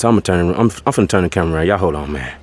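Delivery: normal talking voice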